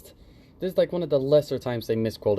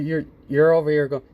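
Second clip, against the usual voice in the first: usual speaking voice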